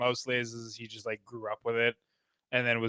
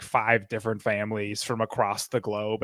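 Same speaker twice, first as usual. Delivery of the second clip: ordinary talk, nothing marked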